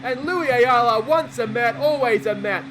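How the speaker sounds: loud, elated salute; jubilant commentary. Real, not acted. Commentator hails Ayala after the home run, loudly jubilant. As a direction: keep the celebration ringing, pitch coming down.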